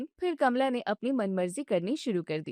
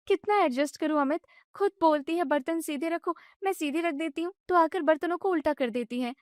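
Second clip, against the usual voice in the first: higher, fast, agitated wife; upset young wife; 6 semitones higher than usual. Acted. Wife vents to her husband about his mother, agitated and high. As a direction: vent it all at once.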